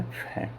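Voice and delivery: aspirated consonant opening फे; फे as the sound of "ph"; second sound of P. Shown as a demonstration. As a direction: say फे with an airy "f"-like start. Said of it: "P के दो उच्चारण होते हैं".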